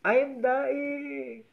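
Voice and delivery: high, drawn-out, loud dying wail; dying fish. Acted. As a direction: play a fish's hammed-up last words, slow and high. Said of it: "Ano ang sinabi ng isda nung namatay na siya". (mataas at mahabang boses ng naghihingalong isda)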